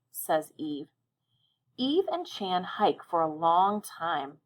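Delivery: the teacher reads the weekly story aloud to her class, slow and expressive; reading to children. Acted. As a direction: read it slowly and clearly to young listeners.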